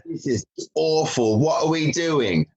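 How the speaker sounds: high, mock-posh English accent; a spur-of-the-moment English accent, pitched well up; put-on English accent. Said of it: "I don't know why I did that accent".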